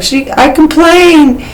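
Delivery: a small, confused voice that corrects itself; whiny self-quote. Retold or imitated. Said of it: "I'm like".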